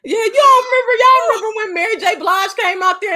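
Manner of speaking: high, loud, excited. Real, not acted. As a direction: gush with excitement. Excited about [name].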